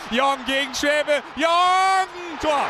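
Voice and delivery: shouted goal commentary, high; goal call. Acted.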